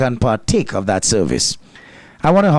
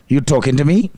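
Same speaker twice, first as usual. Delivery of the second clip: usual speaking voice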